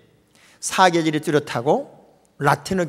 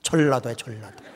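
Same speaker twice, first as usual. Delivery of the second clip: ordinary talk, nothing marked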